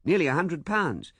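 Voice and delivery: eager. A narrator's reading. Man presses his case.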